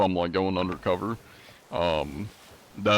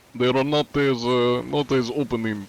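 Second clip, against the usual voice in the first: hammed-up Russian accent, slightly higher; 4 semitones higher, 3 dB louder than usual. Streamer wraps up his theory for chat in a Russian accent. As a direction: finish the thought as the big sweaty Russian man. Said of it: "when I do the Russian accent".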